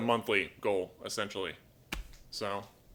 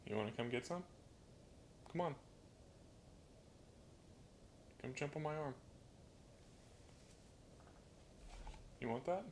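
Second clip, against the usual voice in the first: quiet, coaxing words to a bird; soft pet talk; 4 semitones higher, 15 dB quieter than usual. Real, not acted. Owner softly coaxing the parrot onto a perch, quiet pet talk. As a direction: gently coax the bird to come over.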